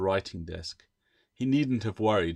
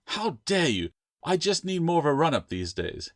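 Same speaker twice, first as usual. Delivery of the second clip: indignant lizard voice, pitched up; lightly raised, indignant familiar; protesting gecko; 7 semitones higher than usual. A narrator's reading. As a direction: huff it out like an offended pet. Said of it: "Echo protested".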